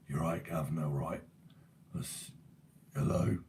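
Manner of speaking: put-on low rasping old smoker voice; raspy voice. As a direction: croak it like a heavy smoker. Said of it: "He's got a voice like that".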